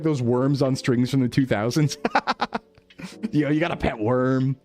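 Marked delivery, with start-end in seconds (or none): none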